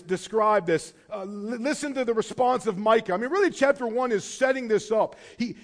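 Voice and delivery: ordinary talk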